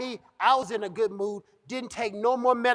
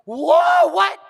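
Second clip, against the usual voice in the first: loud, high, startled yell; freaked-out outburst; 8 semitones higher, 9 dB louder than usual. Acted. Boss freaking out at what he hears, high startled shout. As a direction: blurt it out in shocked disbelief. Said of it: "oh, he freaked out".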